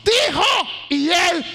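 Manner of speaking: high, loud, emphatic sermon climax; ringing pulpit proclamation; full-force preaching. Real, not acted.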